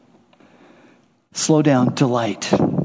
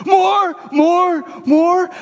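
greedy dog; 14 semitones higher, much slower than usual. Retold or imitated. Greedy dogs gulping food.

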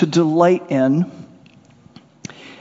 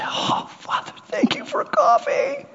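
laughing prayer; 5 dB quieter than usual. Acted. Mock prayer over coffee.

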